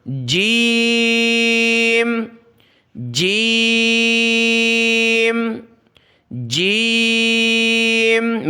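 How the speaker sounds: long held jeem, "جیم" on one steady note; drawn-out recitation. Shown as a demonstration. Teacher has pupils read along, "جیم" drawn out long. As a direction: stretch the vowel of "جیم" very long. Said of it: "میرے ساتھ آپ پڑھتے جائیں".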